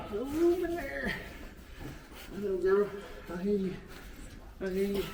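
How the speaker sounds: high, strained murmur; strained talking to animals. Real, not acted. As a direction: talk to the ewe while straining. Straining, reaching in.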